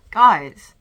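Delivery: very loud call to viewers; loud exclamation. Real, not acted. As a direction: shout the word out. Loud call before a complaint.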